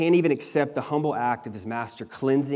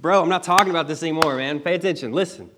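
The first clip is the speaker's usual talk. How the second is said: fast, higher and louder mock-exasperated; casual exasperated bro; 5 semitones higher, 5 dB louder, faster than usual. Preacher imagining Jesus telling Peter to listen, fast and exasperated. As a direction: toss it off like a friend losing patience. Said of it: "It's like Jesus is like".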